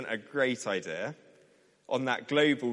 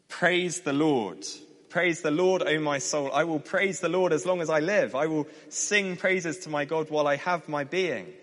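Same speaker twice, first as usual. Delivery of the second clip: louder, higher psalm reading; declaimed Bible verse; scripture reading; 5 semitones higher, 4 dB louder than usual. Acted. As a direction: read out praise to the congregation, lifted and strong. Reads the psalm aloud.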